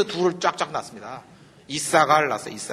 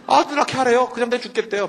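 indignant outburst; 7 semitones higher, 4 dB louder than usual. Real, not acted. Indignant retelling of Rachel.

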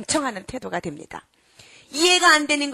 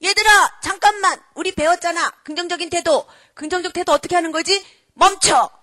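high, loud rallying call; child taking charge; 6 semitones higher, 8 dB louder than usual. Acted. Child to fighting classmates, commanding them loudly. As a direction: burst in and halt the squabble. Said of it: "한 아이가 혜성과 같이 딱 나타나더니".

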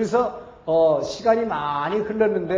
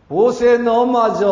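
loud, raised voice of retold judgment; stern rebuke; 4 semitones higher, 8 dB louder, slower than usual. God confronts Moses after he strikes the rock, stern rebuke. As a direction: act out God calling a servant to account, forcefully.

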